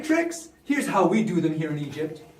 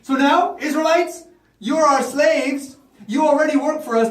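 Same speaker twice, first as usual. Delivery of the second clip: loud, raised-pitch royal order; commanding Pharaoh; 4 dB louder than usual. Acted. Pharaoh punishes the Israelite slaves, forceful command. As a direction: lay down the law as king.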